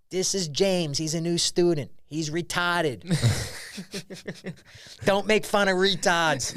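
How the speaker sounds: put-on a light Boston accent, slow on the last line; lightly voiced Boston teacher introducing the boy. Retelling Louis's bit, a teacher's light Boston accent. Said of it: "That's a good Boston accent".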